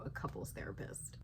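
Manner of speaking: hushed whisper aside; conspiratorial whisper. Real, not acted. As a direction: drop to a secret whisper. Whispered aside about therapy.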